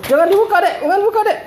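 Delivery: high, loud urgent warning. Real, not acted. Scolding child not to open.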